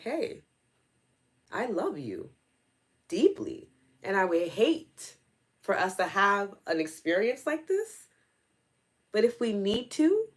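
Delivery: firm, measured warning to a loved one; acted stern reminder, pitch lifted, deliberate pauses; fierce but loving. Aunt reminding her niece of limits, fierce but loving. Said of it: "There's a fierceness that comes out".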